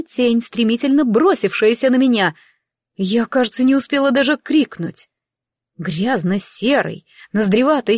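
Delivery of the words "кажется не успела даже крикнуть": usual speaking voice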